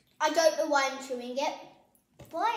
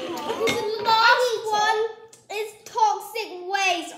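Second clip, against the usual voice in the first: slow, louder, higher build-up; dramatic announcement; 5 semitones higher, 5 dB louder, much slower than usual. Real, not acted. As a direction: announce it with dread. Announcing dreaded sour candy.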